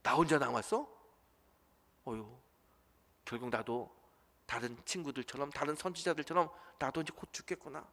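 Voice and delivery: soft, defeated murmur with a sigh; despairing sigh. Acted. Elijah hearing he is alone, quietly despairing. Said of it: "이야기를 듣습니다".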